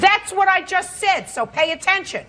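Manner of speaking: high, sharp scolding snap; snapping. Real, not acted. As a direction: cut him off sharply. Judge snaps at defendant.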